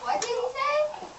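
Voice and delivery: high, loud startled question; acted panic at what was heard; alarmed question. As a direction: ask in stagey alarm, pitch jumping up. Pig mishears the wolf outside.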